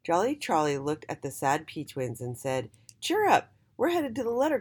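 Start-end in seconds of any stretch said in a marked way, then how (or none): 3.0-4.6 cheerful. Acted. Jolly cheering up sad twins.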